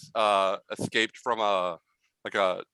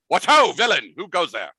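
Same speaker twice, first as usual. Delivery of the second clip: gallant mouse challenge; 8 semitones higher, 7 dB louder than usual. Acted. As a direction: square off with a dramatic hail. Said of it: "standing off and going".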